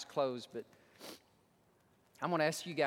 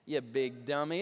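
normal talking voice

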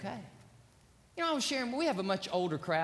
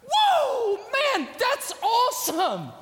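excited exclamation; 8 dB louder, much slower than usual. Acted.

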